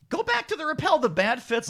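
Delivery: high-pitched imitation of a worried client; retold wary client. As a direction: raise the voice high, imitating their request. Retold wary clients asking back.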